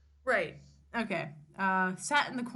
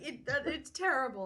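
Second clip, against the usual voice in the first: ordinary talk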